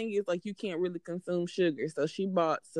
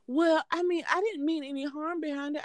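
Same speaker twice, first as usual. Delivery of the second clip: high mock-innocent excuse; lightly voiced coworker pleading innocence; defensive coworker; 9 semitones higher than usual. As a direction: play the coworker backpedalling. Coworker excusing herself, retold.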